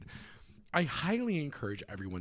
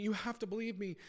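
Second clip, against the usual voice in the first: desperate Riku; 8 semitones higher than usual. Acted. Riku pleads with Sora.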